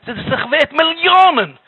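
high, loud exclamation of wonder; amazed praise. Acted. Rebbe marvels at daily Mishna.

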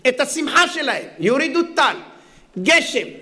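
normal talking voice

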